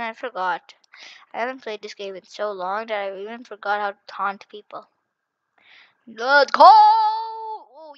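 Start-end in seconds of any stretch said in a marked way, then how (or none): 6.1-7.9 loud, high drawn-out cheer; triumphant shout. Real, not acted. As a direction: yell it out and hold the last sound high. Celebrating in game.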